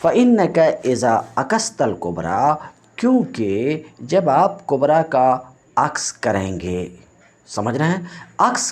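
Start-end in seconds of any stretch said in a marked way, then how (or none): 0.0-2.5 fast classroom recitation of a quoted rule; quick textbook recitation. Shown as a demonstration. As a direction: rattle off the Arabic line fast, reciting. Teacher recites the Arabic text.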